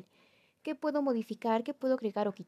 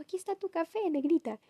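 sweet dad voice; 7 semitones higher than usual. Retold or imitated. Dad's sweet greeting voiced.

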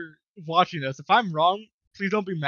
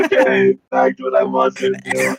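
deep, loud robot voice, laughing through it; 9 dB louder than usual. Acted. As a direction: machine voice cracking up. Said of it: "Why the fuck are you talking like this?".

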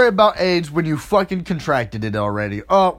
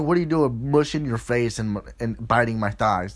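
quiet, low mock whine; hushed put-on complaint; lower, softer complaining partner; 9 semitones lower, 6 dB quieter than usual. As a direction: grumble it lower and softer as her. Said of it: "They're like".

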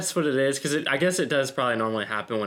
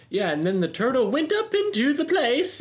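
random voice switch; 11 semitones higher than usual. Pretend. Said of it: "it's just like".

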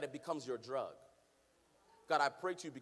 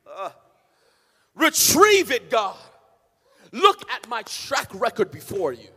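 loud, chanted preaching over the organ, slower on the pleas; preaching at full force; 6 semitones higher, 21 dB louder than usual. Acted. Pastor urging the congregation to pray boldly, loud chanted preaching. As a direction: climb into a sung, shouting sermon climax.

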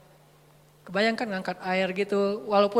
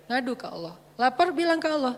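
ordinary talk, nothing marked

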